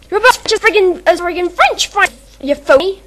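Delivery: put-on loud, high Osaka-girl outburst; Kai's voice. Fan asked for her character's line, loud high Kai outburst. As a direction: yell it like an angry Kansai girl. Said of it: "try doing Kai's voice".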